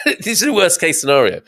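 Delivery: laughing. Real, not acted. Laughing at own joke.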